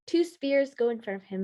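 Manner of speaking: ordinary delivery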